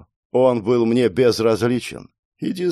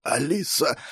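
faint call, higher and softer; weak pleading; 4 semitones higher, 5 dB quieter than usual. Acted. Dying boy calls his girlfriend, weak pleading. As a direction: call out with no strength left.

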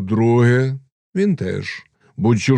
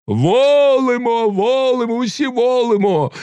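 high, long-held acclamation; crowd shouting assent; 14 semitones higher than usual. A narrator's reading. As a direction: cry out approval as a whole assembly. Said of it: "загукала козацька громада".

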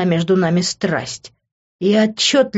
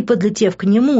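ordinary delivery, nothing marked

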